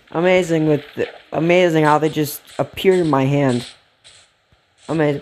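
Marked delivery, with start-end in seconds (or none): none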